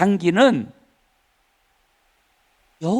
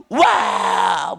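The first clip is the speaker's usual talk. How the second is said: mock a noisy reader's long holler; imitated shout. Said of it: "소리는 중요하지 않아요".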